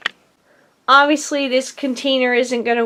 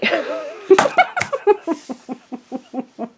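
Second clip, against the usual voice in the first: bright giggle at herself; giggling; 6 semitones higher, 4 dB quieter than usual. Real, not acted. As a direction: laugh it off, a little embarrassed. Laughs at her bling love.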